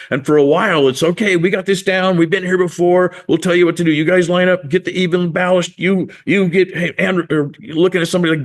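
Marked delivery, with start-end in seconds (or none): 1.0-7.2 higher-pitched, hurried crew orders; a touch of a busy skipper; lightly acted take-charge fisherman. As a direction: organize the crew as a seasoned boatman. Acted apostles taking charge in boat.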